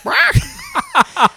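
sudden high squawk; seagull squawk. Retold or imitated. Storyteller imitates the seagull staring at him, high squawk.

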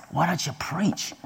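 soft, confiding quoted question; hushed request. Retold or imitated. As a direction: ask it under your breath. Old lady's hushed request, retold.

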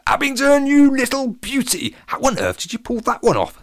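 high, loud exclamation with stretched words; thrilled, high-pitched congratulations; excited. A narrator's reading. Latham congratulates Abingdon on winning the match, excited. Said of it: "said Latham excitedly".

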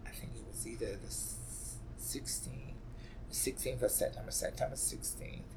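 whispered, voice dropping away in grief; hushed whisper. Real, not acted. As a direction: barely breathe the date out. Grieving, recalling the death date.

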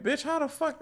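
high, squealing mock shock; shocked disbelief. Telling a friend about his drunk date, high mock shock. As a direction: blurt it out in stunned disbelief. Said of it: "I'm like".

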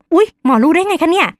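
imitating the patient caught off guard; startled surprise. Patient startled that the doctor guessed her symptoms, high surprised voice. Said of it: "น้องแบบตกใจค่ะ อารมณ์แบบ".

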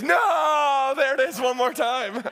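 high shouted mock No that slowly settles; tired parent's hammed-up despair; mock anguished yell. Parent sees kids awake.

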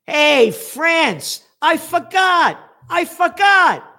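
hammed-up yell of fake forgetfulness; mock shout. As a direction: holler across the sea to taunt France.

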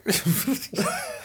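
high burst of laughter; laughing. Real, not acted. Laughing at dark joke.

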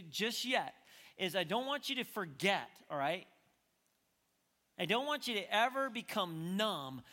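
emphatic pulpit voice, punching the key words; forceful preaching. Real, not acted. Preacher urging listeners never to become numb, forceful preaching. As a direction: urge it on them, leaning on big words.

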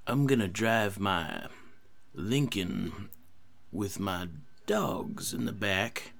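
slow drawled Texan drawl, lightly done; a lazy Texan drawl trailing to a whisper; Matthew McConaughey impression. Lightly done Texan drawl playing Matthew McConaughey for the game. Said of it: "let me see Matthew McConaughey here".

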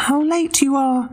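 very high teasing pig; cheeky little pig. Acted. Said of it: "he called out".

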